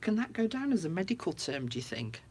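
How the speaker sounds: ordinary talk, nothing marked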